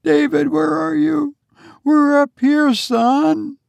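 high, insistent mother's call; sobbing. A narrator's reading. Mother answering her son below, mildly sobbing. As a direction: call out high with a touch of tears. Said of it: "she sobbed".

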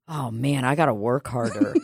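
low, soft mock self-reproach; envious comic. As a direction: lightly voice a jealous, dejected comic. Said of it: "be like".

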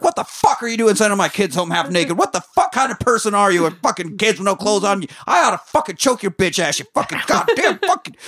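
high, furious phone rant; angry yelling. Retold or imitated. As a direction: rant at the teacher, losing it. George rages at teacher on phone.

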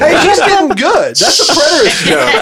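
high, loud, laughing remark; laughing hard. Real, not acted. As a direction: crack up while saying it. Cracking up at the joke.

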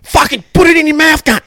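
high, loud and fast; hammed-up Aussie abuse; mock Aussie heckler. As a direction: rattle it off like a drunk Australian fan. Friend joins mocking drunk heckler.

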